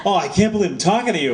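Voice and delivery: high, quick mock-gushing; starstruck gushing. Pitcher gushing back at his favorite author, playfully starstruck. Both men gush back and forth.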